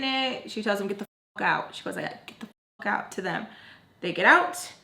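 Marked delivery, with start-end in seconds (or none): 2.3-3.1 softly whispered imitation of a command; hushed retold order. Quoting Spice ordering them out, hushed. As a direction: whisper the order under your breath, quoting her. Repeats the order, demonstrating how said.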